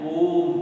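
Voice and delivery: ओम held extra long and full. Shown as a demonstration. Teacher showing the class the longest vowel, ओम. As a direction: stretch ओम out for three beats. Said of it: "इसमें लंबा समय लग रहा है".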